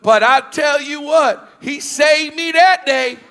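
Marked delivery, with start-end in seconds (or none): none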